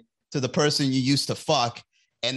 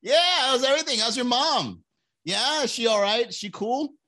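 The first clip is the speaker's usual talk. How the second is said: fake friendly; 11 semitones higher than usual. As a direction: gush with fake delight at the ex. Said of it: "you do that fake".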